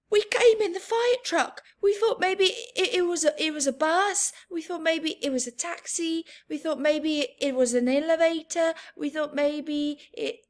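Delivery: tumbling nervous excuses; child babbling excuses to the fire chief; fast babbling kid. Acted. Sheila's rambling excuse.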